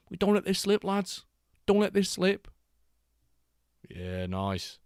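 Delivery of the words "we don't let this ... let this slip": high, exaggerated Scouse accent, rallying the lads. Streamer repeats the rally line in a mock Scouse accent. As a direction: ham up a Liverpool rallying cry. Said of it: "Or in a Scouse accent".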